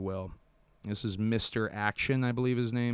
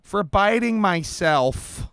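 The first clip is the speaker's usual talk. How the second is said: higher, very slow, dopey impression; host trying to top his co-host's Josh impression, slow and high; mock Josh impression; 11 semitones higher, 7 dB louder, much slower than usual. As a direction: drawl it out high and dazed to mock him. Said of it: "My, my, my impression can't touch yours".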